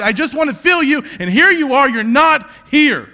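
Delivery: loud, high anguished cry to God; shouting prayer in despair; desperate shout. Retold or imitated. Retold drunken despair prayer.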